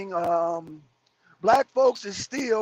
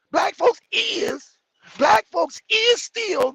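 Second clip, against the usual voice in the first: mocking broken English; 8 semitones higher, 3 dB louder, slower than usual. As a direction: ham up the grammar mistake in a raised voice. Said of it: "What kind of English is that?".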